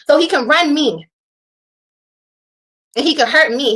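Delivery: normal talking voice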